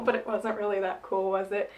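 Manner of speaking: ordinary talk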